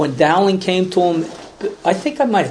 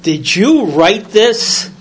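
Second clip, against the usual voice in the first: priest's question, louder, higher and slow; intense priest; 5 semitones higher, 5 dB louder, slower than usual. Retold or imitated. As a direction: lean in and demand it slowly, like a searching priest. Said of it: "And he goes".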